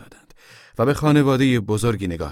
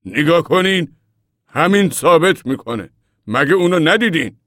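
high, loud indignant reproach; cross; 11 semitones higher, 9 dB louder than usual. A narrator's reading. Old man shows the mother the door sign, crossly. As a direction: point out the mistake, voice raised crossly. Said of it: "با اوقات تلخی".

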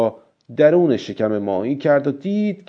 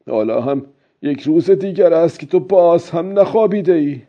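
put-on high, raised old fisherman's voice, easing toward the end; loud self-talk; 6 semitones higher than usual. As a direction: call out to yourself, urging and scolding. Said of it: "بعد با صدای بلند گفت". (با صدای بلند، خطاب به خود)

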